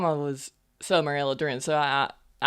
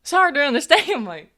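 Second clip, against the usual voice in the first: high, louder incredulous repeat; indignant echo; 10 semitones higher, 5 dB louder than usual. Acted. Half-human repeating the complaint about her, indignant. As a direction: fling the complaint back, pitched way up.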